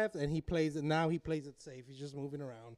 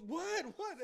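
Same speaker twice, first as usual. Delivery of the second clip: high, softer imitated questioning; startled mom; 10 semitones higher, 9 dB quieter than usual. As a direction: squeak it like a startled mother. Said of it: "And she goes".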